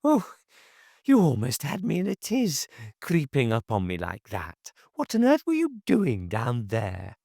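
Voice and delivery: high, fussing reproach; flustered busybody. A narrator's reading. Neighbour fussing at Hashiva for creeping up on her, high and flustered. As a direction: scold him in a high fluster.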